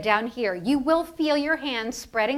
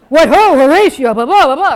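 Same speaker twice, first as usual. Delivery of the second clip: very loud mock stage declaiming; Shakespearean actor; 3 semitones higher, 15 dB louder than usual. Coach imitating live Shakespeare actors, booming mock declamation. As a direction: boom it out like a hammed-up Shakespeare player. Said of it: "a live Shakespeare performance".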